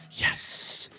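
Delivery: whispered, intense inner cheer; secret triumph. Retold or imitated. Prankster hearing his wife scream, secretly triumphant whisper. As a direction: whisper it like a private victory.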